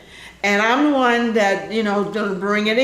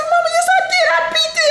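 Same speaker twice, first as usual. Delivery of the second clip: insistent protest; 3 dB louder, faster than usual. Retold or imitated. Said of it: "I said".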